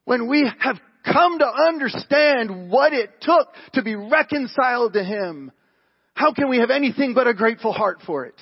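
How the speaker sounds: loud, high, emphatic sermon delivery; impassioned preaching. Acted. Preacher urging grateful hearts for reconciliation, impassioned preaching. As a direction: press the appeal on them at full force.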